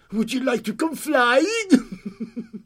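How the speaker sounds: high, drawn-out creature voice; slow, teasing invitation ending in a chuckle; little night creature. Acted. Creature invites girl to fly, high and drawn out.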